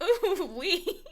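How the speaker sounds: laughing so hard the voice squeaks up high; real wheezing laugh at the absurd story; helpless laughter. Real, not acted. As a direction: crack up, talking through the laugh. Laughing at the backstory.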